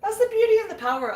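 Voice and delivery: rushing the words through tears; crying. Real, not acted. Mother telling her daughter's story, tearful and rushed. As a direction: push through while crying. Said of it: "Oh, look, I'm crying".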